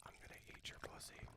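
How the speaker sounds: breathy ASMR whisper. Pretend. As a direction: whisper it close to the mic. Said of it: "could you whisper".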